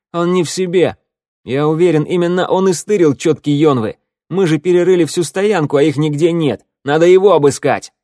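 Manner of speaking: loud, high-pitched insistence; heated accusation. A narrator's reading. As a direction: rouse the others against him. Ворона publicly accuses Тео. (обвиняя, громко и напористо)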